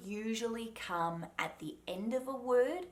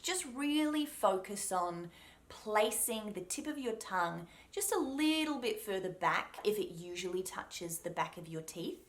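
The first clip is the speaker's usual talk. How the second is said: ordinary delivery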